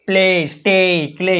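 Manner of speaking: "પ્લે, સ્ટે, ક્લે" with an added ee glide; mispronunciation demo. Shown as a demonstration. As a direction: say "પ્લે, સ્ટે, ક્લે" as pley, shtey, kley. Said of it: "એવું નહીં".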